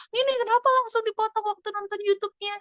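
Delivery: put-on high whiny child voice; child complaining. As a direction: complain like a sulky kid. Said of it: "Biasa alasan kan". (anak merengek dengan suara tinggi)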